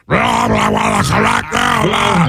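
a high, hammed-up gibberish take on the caller; mock the caller's rasp with nonsense syllables; mock raspy caller. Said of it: "why don't you clear that guy out of your throat".

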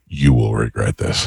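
low, slow mock threat; mock-ominous warning. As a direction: deliver a solemn warning, drawn out low. Retold mock warning to partner.

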